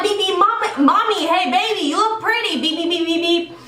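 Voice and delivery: high, teasing singsong catcall; mock catcall. Driver mockingly catcalling the boy from her car, teasing.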